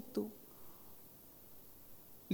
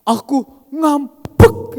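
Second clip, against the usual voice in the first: put-on high, very slow childlike sulk; playful pretend sulk; [name] sulking playfully; 6 semitones higher, 10 dB louder, much slower than usual. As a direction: announce the sulk like a child who is half laughing. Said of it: "ngambeknya orang panti asuhan sambil ketawa".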